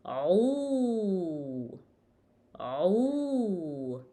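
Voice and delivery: "Aw" from ain into a long oo; drawn-out Arabic "Aw", gliding to oo; ain with dhamma. Shown as a demonstration. Teacher shows ain with dhamma, long aw. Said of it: "Ain with dhamma".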